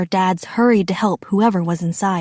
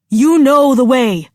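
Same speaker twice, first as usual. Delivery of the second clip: louder, urgent reassurance; yell; 6 dB louder than usual. A narrator's reading. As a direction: call it across a distance. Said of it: "Akira's dad yelled".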